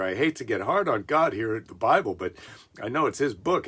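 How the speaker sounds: ordinary talk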